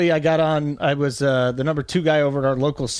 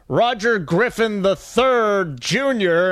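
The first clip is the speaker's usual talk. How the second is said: slow, drawn-out announcer voice stretching every name; Dick Stockton impression; 4 semitones higher, much slower than usual. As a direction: mimic an old play-by-play man fumbling the name slowly. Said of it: "when he goes".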